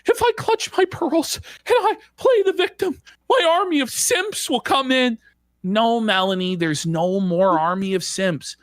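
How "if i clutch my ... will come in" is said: high whiny mock quote. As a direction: mimic her as a whiny victim.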